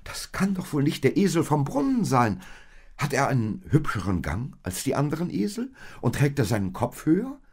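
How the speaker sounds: high, quickening string of questions; eager. A narrator's reading. Maria asks Josef if it is the well donkey, eager. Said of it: "fragte Maria eifrig".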